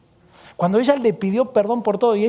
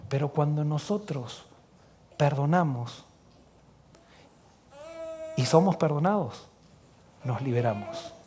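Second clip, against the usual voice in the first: soft closing altar call; 5 semitones lower, 9 dB quieter than usual. Acted. Closing appeal of sermon.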